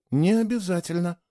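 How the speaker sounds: ordinary delivery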